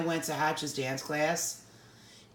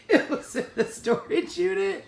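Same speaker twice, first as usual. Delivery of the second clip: squeezing the words out through laughter; helpless giggly telling; laughing while talking; 12 semitones higher, 4 dB louder than usual. Real, not acted. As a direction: crack up as you try to tell it. Cracking up while telling story.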